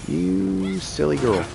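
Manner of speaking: ordinary talk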